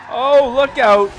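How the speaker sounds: real alarm, drawn out; startled shout. Real, not acted. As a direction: yelp as the car slides. Car nearly skids into wall.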